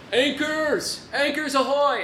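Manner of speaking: high, loud sailor cry; excited shout. Acted. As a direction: bellow it like an excited pirate. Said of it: "And he says".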